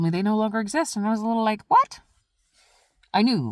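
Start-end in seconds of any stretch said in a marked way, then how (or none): 1.7-2.0 high startled what; a sudden shocked squeak; surprised. Retold or imitated. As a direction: jolt up in disbelief.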